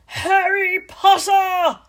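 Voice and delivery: loud, high, slow outraged cry; furious shout. Acted. McGonagall shouts at Harry across the lawn, loud and slow. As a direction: stop him dead with his name. Teacher catches him flying.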